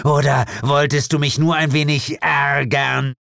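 high, accusing questions; indignant demon mother. Acted. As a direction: needle her with angry suspicion.

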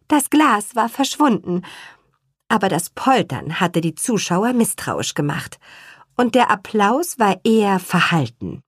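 ordinary delivery, nothing marked

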